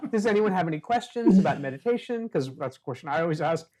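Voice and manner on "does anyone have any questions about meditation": put-on higher, louder kid-instructor voice; child teacher. Child asks imaginary Zoom class for questions, teacherly. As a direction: imitate a kid addressing the class.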